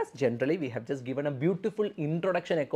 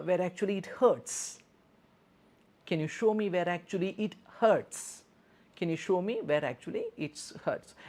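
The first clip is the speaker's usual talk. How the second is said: higher, gentle nurse voice; caring nurse; 6 semitones higher than usual. Shown as a demonstration. Nurse assessing a patient's hurt toe, higher and gentle. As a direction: soothe and question the patient kindly. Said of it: "teacher demos nurse role".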